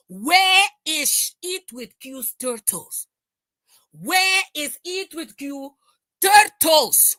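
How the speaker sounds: drawn-out taunt that ends in a shout on turtles; mock her slowly, then yell the last word. Real, not acted. Streamer taunting a rival's mother, slow then shouting.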